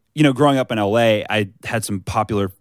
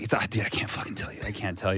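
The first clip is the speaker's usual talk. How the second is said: retold whisper; 3 semitones lower, 10 dB quieter than usual. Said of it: "And he's just like".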